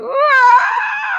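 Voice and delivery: very high, sudden wailing yell; a hammed-up cry of despair; mock anguished shout. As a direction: shriek out like you're bawling. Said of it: "And I'm just like".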